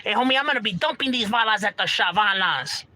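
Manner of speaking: high, put-on barrio bravado; gimmick homie talk. Shown as a demonstration. Mocking talk that no one really does, overdone. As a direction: ham it up as a try-hard homie. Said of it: "No one's doing that, bro".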